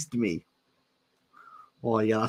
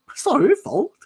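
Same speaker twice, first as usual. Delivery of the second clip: squeaky; 6 dB louder than usual. Pretend. High mock voice.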